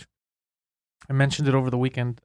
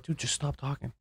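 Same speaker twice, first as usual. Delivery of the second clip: much softer, urgent hush; hushed warning; 13 dB quieter than usual. Retold or imitated. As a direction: quietly shut a blabbing friend down. Hushing a friend confessing crimes.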